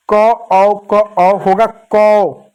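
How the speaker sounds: drawn-out "क... औ... कौ", letter by letter; slow sounding-out. Shown as a demonstration. Teacher showing how the matra joins क, slow sounding-out. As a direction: stretch "क" and "औ" separately, then blend into a long "कौ". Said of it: "कैसे पढ़ेंगे".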